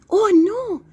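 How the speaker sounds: high, loud dismayed oh no; alarmed cry. Acted. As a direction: gasp it out in alarm.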